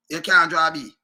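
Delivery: ordinary talk, nothing marked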